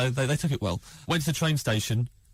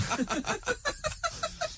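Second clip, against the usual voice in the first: a squealing laugh, softer; high laugh; 6 dB quieter than usual. Real, not acted. Comedian laughing at his friend's defence, high squealing laugh. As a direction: crack up at the punchline.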